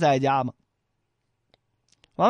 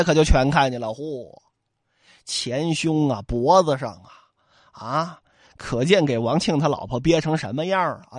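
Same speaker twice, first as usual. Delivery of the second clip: ordinary delivery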